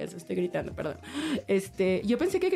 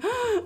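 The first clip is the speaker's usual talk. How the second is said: a sudden high "¡Ah!", much higher; retold cry of pleasure; 14 semitones higher than usual. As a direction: re-enact the sharp climax cry. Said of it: "le dije". (grito agudo de placer, recreado)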